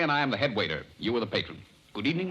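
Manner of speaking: ordinary talk, nothing marked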